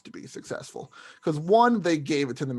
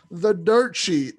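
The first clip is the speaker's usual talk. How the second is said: slow, drawn-out ring announcer hype, a bit louder; 4 semitones higher, 6 dB louder, much slower than usual. Pretend. Podcaster introducing the segment to his cohost in a mock announcer voice. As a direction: stretch "The Dirt Sheet" like a big announcement.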